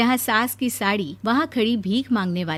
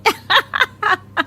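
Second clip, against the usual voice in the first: mocking laugh; 11 semitones higher than usual. Bride laughs at joke.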